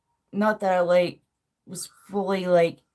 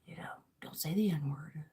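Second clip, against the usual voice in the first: whispering; 3 semitones lower, 12 dB quieter than usual. Retold or imitated. Quoting a warning, whispered.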